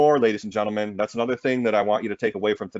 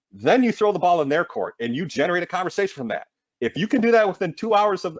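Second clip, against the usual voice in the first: high-pitched, real excitement; too excited; 7 semitones higher than usual. Real, not acted. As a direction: get carried away as you coach, pitch climbing. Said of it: "sorry, I'm getting too excited here".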